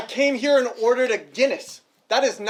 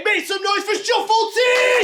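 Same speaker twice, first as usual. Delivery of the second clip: crowd hype shout; 7 semitones higher than usual. Real, not acted. Host hypes the crowd.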